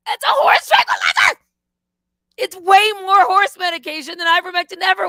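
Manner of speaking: high, loud indignant cry; outraged shout. Real, not acted. Ranting to viewers about ketamine ads, outraged shout. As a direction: yell it in disbelief.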